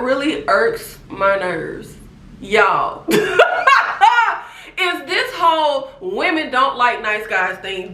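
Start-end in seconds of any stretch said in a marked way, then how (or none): 3.2-4.4 high burst of laughter; real laugh mid-rant. Real, not acted. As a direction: crack up.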